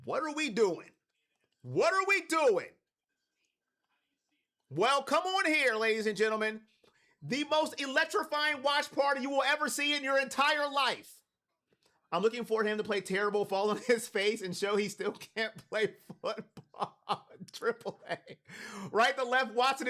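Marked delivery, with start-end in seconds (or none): 1.6-2.7 high, emphatic disbelief; exasperated outcry. Real, not acted. Repeats question in exasperation. 4.7-11.1 high-pitched ringmaster welcome; hype announcer. Real, not acted. Hypes the watch party. 13.5-18.2 words breaking up into laughter; laughing while reading. Real, not acted. Laughs at a harsh chat message.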